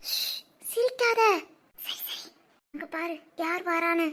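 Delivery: hushed. Acted. Hushing a giggling girl.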